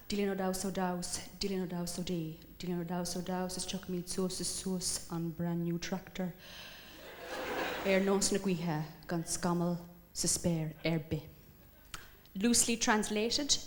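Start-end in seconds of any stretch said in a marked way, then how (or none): 0.1-11.2 quiet, measured recital in mock Irish; mock Irish warning. Singer warning single ladies, quiet mock-solemn Irish recital. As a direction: intone it softly like an old Irish verse. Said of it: "it goes something like this".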